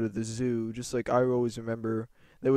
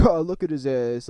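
higher, louder jeering teacher impression; imitated mocking laughter; 4 semitones higher, 8 dB louder than usual. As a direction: act a teacher laughing at a pupil. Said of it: "laughing at me".

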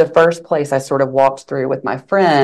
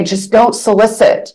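louder, higher imitation of a stern warning; raised-voice mock command; retold forceful order; 7 semitones higher, 6 dB louder than usual. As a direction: lay down the rule, imitating him. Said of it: "it was forceful".

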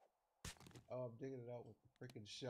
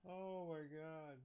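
ordinary talk, nothing marked

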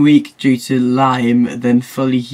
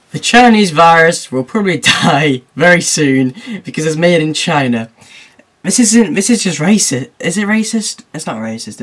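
normal talking voice

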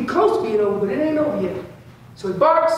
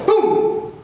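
bark demo; 8 semitones higher than usual. Shown as a demonstration. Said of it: "And I'm chopping it off".

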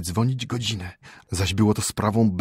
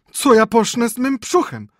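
very high, loud whining wail; little old man wailing for his belly; whimpering; 14 semitones higher, 7 dB louder than usual. Acted. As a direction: whine it like a frightened old man. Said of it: "skomlał ród".

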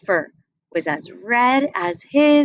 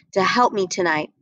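ordinary delivery, nothing marked

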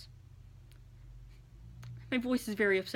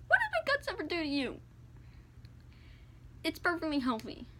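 ordinary talk, nothing marked

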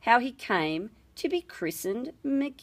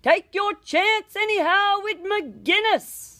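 high, louder shout; priest's shout; 10 semitones higher, 4 dB louder than usual. A narrator's reading. Priest shouts a name at the escaping boy, loud. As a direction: fling the name after the fleeing boy. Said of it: "the priest gave a shout".

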